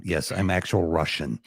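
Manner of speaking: low, deadpan mock Russian accent. Co-host kidding the host, a lightly put-on Russian accent. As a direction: claim to be Russian in a joking Russian accent. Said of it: "I caught the Russian accent".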